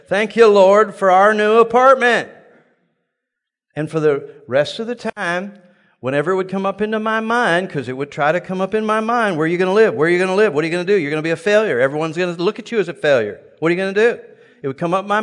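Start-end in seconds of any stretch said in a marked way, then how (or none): 0.1-2.3 louder, higher praise of thanks; joyful praise. Retold or imitated. Preacher reenacting praise in bed with wife, joyful. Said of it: "worshiping the Lord in bed". 9.4-14.2 taunting inner doubts. Retold or imitated. Preacher voicing the doubts in his mind, taunting.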